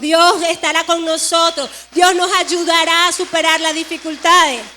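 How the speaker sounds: rally the congregation with growing force; preaching exhortation. Acted. Exhortation to new believers.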